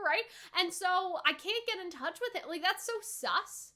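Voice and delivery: ordinary talk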